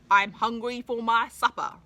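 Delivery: loud, hungry troll roar. Acted. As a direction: roar it at the goat. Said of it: "roared the troll".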